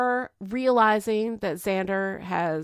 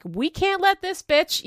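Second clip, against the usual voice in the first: mock angry rant; 7 semitones higher, 3 dB louder than usual. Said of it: "getting angry and being like".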